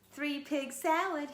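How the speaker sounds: moaning. Acted. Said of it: "he moaned".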